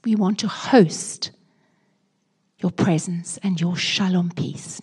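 hushed, slow earnest prayer to the Lord. Acted. Pastor praying for the congregation, hushed and earnest. Prays aloud in the sermon.

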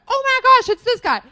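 high, loud mock gasp of discovery; amazed recognition. Host voicing a viewer searching an actor, amazed recognition. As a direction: exclaim it like a sudden discovery.

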